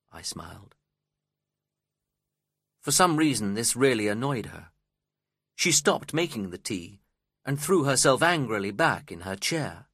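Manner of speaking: plain reading voice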